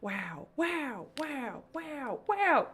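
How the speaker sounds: soft wows stepping up in pitch; playful wows. Pretend. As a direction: start small and climb with every wow. Jokey repeated wows.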